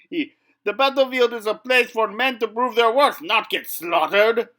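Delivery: loud, high, outraged; indignant. Acted. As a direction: scold like a proud knight. Knight continues outraged rant.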